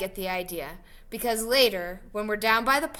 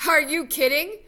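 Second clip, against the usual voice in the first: higher, louder disbelieving retort; incredulous; 6 semitones higher, 7 dB louder than usual. Acted. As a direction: throw it back in disbelief. Incredulous reply to friend's question.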